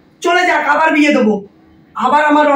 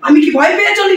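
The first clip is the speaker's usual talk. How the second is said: defiant shout; 5 semitones higher than usual. Acted. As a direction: shout so they hear it. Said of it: "আর একটু আস্তে কথা বলো". (জেদ করে চেঁচিয়ে বলা)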